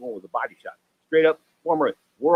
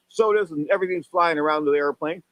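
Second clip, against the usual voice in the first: ordinary delivery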